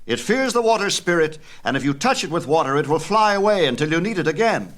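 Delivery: a fiery titan excitedly presenting his gift; Prometheus teaching fire. Acted. Prometheus instructs men about fire.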